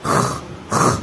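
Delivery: whispered throaty "خ" demo; voiceless rasp. Shown as a demonstration. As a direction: scrape air through the back of the throat. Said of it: "اندر سے ہوا کا pressure مارنا ہے".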